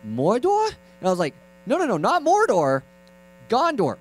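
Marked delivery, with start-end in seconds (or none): 0.0-0.7 put-on high, questioning little-kid voice; mock clueless child; imitated young nephew. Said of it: "And he's like". 1.6-4.0 high, insistent mock exasperation; acted frustrated uncle; exasperated correction.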